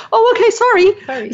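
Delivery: high, drawn-out mock-English "sorry"; English accent. Therapist mimicking a spouse's drive-by sorry, English accent. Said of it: "I put an English accent on there".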